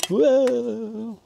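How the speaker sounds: high startled whoa; surprised whoa. Real, not acted. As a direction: jump at it, startled. Surprised exclamation at cooking pot.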